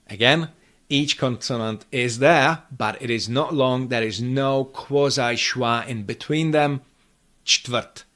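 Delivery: ordinary talk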